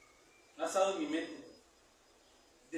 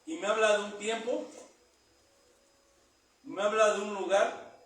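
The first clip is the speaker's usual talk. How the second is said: preaching at full force; 3 semitones higher, 4 dB louder than usual. Acted. Preacher proclaiming the promise of power, loud. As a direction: proclaim it loudly to the congregation.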